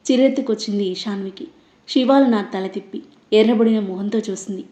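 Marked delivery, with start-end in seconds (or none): none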